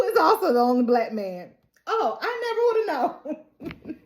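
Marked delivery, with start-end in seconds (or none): 1.9-4.0 high, sarcastic feigned surprise; mock surprise. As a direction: play amazed through a laugh. Mocking an obvious reveal.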